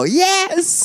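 high-pitched imitation of a crowd; excited mock crowd cheer. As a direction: mimic an eager crowd's yes. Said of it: "the fucking crowd will go".

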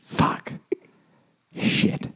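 swearing under his breath; soft, voiceless curse to himself; real quiet dismay. Real, not acted. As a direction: let it sink in, barely voiced. Learns he has repeated his story.

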